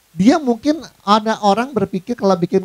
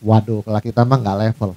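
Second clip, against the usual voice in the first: lower, haughty put-down; a lightly voiced snob looking down; snobbish boast; 8 semitones lower than usual. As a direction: sniff at the others from above. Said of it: "Misalnya begini nih".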